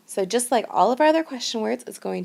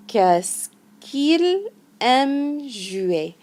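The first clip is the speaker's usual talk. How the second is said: very slow, higher and louder "Qu'est-ce qu'il aime jouer", each syllable drawn out; slow model phrase; 6 semitones higher, 4 dB louder, much slower than usual. Shown as a demonstration. Teacher drills the class on "Qu'est-ce qu'il aime jouer", very slow. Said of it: "And that sounds like".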